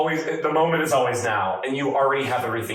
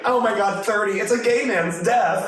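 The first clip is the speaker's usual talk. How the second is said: dramatic self-quote; 8 semitones higher than usual. Retold or imitated. Quoting himself at a bar.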